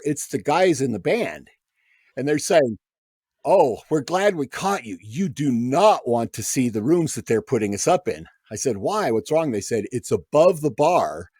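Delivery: ordinary talk, nothing marked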